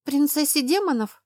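appalled disbelief, voice going up; horrified. A narrator's reading. Girl balks at telling the demon princess, horrified. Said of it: "ужаснулась она".

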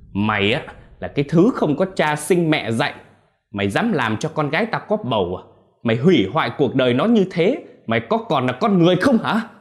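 high, loud scolding that swells; angry berating. A narrator's reading. Father confronting the boy who got his daughter pregnant, angry berating. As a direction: tear into the boy with rising fury. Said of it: "chỉ tay vào mặt thằng Vinh chửi".

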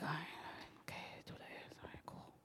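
whispered, barely voiced pep talk; self-talk under the breath. Driver reassuring herself after the interview, whispered. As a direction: murmur it very softly to yourself. Talking herself down, alone in car.

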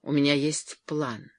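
usual speaking voice

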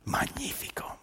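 awed hush; soft unvoiced whisper of wonder. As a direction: breathe it out, amazed. Narrator marvels at drinker.

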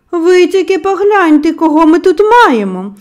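a stranger's loud gotcha; exclaimed. A narrator's reading. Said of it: "вигукнув чийсь бас".

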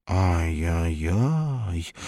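slow, drawn-out mock tut-tut; smiling stranger. A narrator's reading. The smiling stranger mock-pities Hikin, slow tut-tut. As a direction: cluck in mock sympathy, very slowly. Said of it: "молвил, улыбаясь неизвестный".

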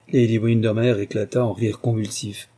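usual speaking voice

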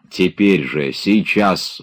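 plain reading voice

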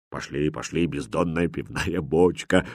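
low, very soft aside; whispering. A narrator's reading. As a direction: murmur the jibe under your breath. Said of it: "прошептал Ватрушка". (тихо, почти шёпотом)

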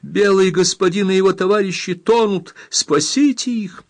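high, pleading alarm; urgent cry for help. A narrator's reading. Girl begs rescue for drowning men, crying out. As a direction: raise the pitch and plead for rescue. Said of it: "закричала она".

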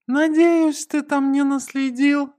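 high, loud character voice; Gonza, pointedly checking. A narrator's reading. Gonza asking about tracks left behind, high loud voice. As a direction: question your friend in a high, loud voice.

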